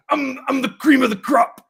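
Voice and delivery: loud, high wrestler boast; Macho Man impression. Podcaster imitating a wrestler's promo boast. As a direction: boom it like a ring promo. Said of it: "And he's like".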